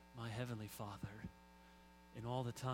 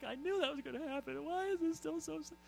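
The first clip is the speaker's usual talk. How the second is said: high, imitating a choked-up viewer; mock teary; 13 semitones higher than usual. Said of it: "and you're like".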